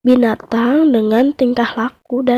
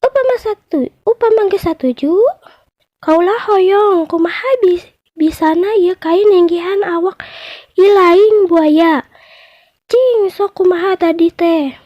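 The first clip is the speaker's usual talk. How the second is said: much higher clever judge voice; mouse deer as judge; 7 semitones higher, 3 dB louder than usual. Acted. Mouse deer judge asks the crocodile to reenact, much higher voice. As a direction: ask them to show it again, a little slyly.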